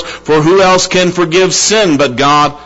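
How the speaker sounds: forceful, ringing sermon delivery; impassioned preaching. Real, not acted. Preacher declaring God alone restores, impassioned preaching. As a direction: preach it out with full conviction.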